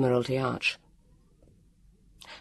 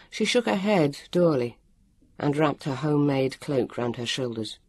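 ordinary delivery

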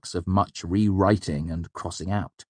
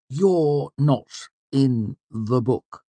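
very slow, word by word, higher-pitched; slow, condescending; 8 semitones higher, 3 dB louder, much slower than usual. A narrator's reading. Clerk explains to supposed simpleton, slow and condescending. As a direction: spell it out as if to a simpleton. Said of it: "as if explaining to a simpleton".

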